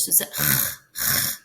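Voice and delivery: ch rasped from the back of the throat, breathy and soft; throaty ch demo. Shown as a demonstration. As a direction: show the Welsh ch from the throat. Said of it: "notice at the back of my throat".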